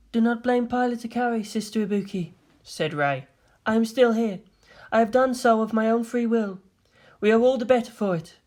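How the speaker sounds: calm Rei. Acted. Rei defending Shinji to Maya, calm and even. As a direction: speak evenly, without emotion.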